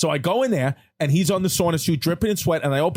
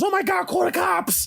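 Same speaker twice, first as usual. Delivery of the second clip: panicked mother; 13 semitones higher, slower than usual. Retold or imitated. Voicing Paulie's mother panicking.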